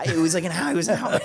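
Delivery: real laughter through the words; amused admission while laughing. Real, not acted. Laughs at his own story.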